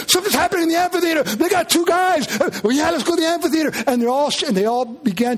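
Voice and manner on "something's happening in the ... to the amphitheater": higher and fast, rushing; excited rumor. Retold or imitated. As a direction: blurt it out fast like gossip. Crowd member spreading rumor.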